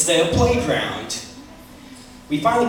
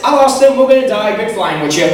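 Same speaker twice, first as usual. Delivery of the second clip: louder, hammed-up movie-pilot despair; mock dramatic; 3 semitones higher, 8 dB louder than usual. Pilot facing death in the real movie, mock loud. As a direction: ham up a doomed Top Gun goodbye. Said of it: "it'd be more like this".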